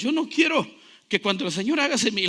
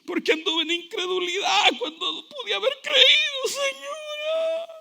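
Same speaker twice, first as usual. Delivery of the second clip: high, wailing, tearful plea; mock weeping; 12 semitones higher, slower than usual. Believer begging the Lord for forgiveness, high tearful wail. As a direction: whimper and wail like a sinner begging. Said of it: "Yo no quiero venir llorando".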